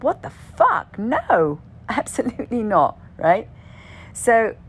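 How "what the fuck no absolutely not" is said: higher, louder shocked outburst, half laughing; shocked refusal. Retold or imitated. To herself in the night, a loud laughing no. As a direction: recoil at the idea with a laughing no. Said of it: "I was like".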